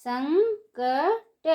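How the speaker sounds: संकट drawn out slowly, syllable by syllable; slow syllable drill. Shown as a demonstration. Teacher spells संकट out slowly for children. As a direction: stretch संकट slowly for the class. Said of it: "उसी को ऊंची आवाज़ में कैसे पढ़ेंगे".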